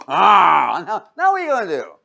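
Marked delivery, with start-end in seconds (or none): 0.1-0.8 loud, odd-voiced meow rising at the end; mock bear roar. Preacher voicing a bear at the back door, mock roar. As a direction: growl it out like a joke bear. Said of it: "there's a bear going".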